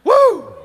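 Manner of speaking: loud, high woo; sudden high-pitched cheer; excited whoop. Real, not acted. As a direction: let out a short triumphant whoop. Exhilarated after the impression.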